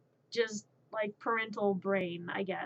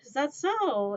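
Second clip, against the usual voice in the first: playful, louder mock-interested questions; playful entertaining; 6 semitones higher, 5 dB louder, slower than usual. Babysitter answering a babbling baby, playfully entertaining. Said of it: "I entertain them with the whole".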